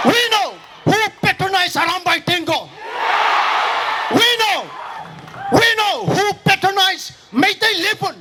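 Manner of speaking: fiery protest address; rally speech. Acted. Rally speech, shouted accusations.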